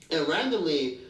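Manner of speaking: ordinary talk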